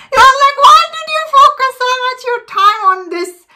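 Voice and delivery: very high, incredulous complaint; squealed mock disbelief; mock exasperation. Acts out viewer's frustrated question.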